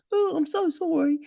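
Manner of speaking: high mock-sorry voice; mock apology. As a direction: ham up a cheap apology. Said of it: "And then it's just a".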